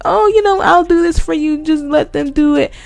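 high, sugary mock manager voice sucking up; fake sweet manager. To the guest, a manager fawning in a high mock-sweet voice. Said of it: "kissing the guest ass like".

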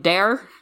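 "dare" as "there" with a hard D. Shown as a demonstration. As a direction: start "dare" on a D sound instead of TH. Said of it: "they add a D".

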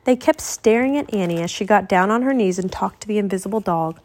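usual speaking voice

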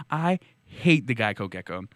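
normal talking voice

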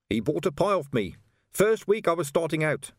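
lightly voiced trader recalling a customer; reminiscing street trader. A narrator's reading.

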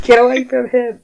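high, loud mock scolding; Craig the bartender. Bartender shooing someone away, high loud impression. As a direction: pitch it way up and call out. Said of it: "That is what he would sound like".

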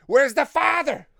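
high, loud, drawn-out cry; mock outraged shout. Host riffing on the bully having no father figure, mock outraged shout.

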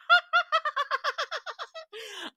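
high burst of laughter. Real, not acted. Laughing up high at his own mail joke.

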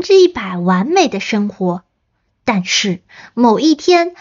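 stiff deadpan reading aloud. Shown as a demonstration. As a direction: read it out flat like a bad actor. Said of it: "我姑且棒读的读一下". (棒读念简介)

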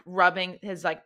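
normal talking voice

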